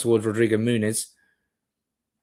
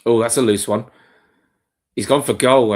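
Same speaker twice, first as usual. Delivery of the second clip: louder, sudden excited call; live shot reaction; 8 dB louder than usual. Real, not acted.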